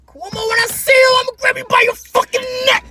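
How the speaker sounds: high, loud hammed-up threat; mock threat. Quoting a protester threatening Cuomo. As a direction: screech the threat.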